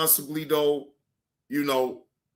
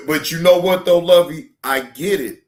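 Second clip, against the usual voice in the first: usual speaking voice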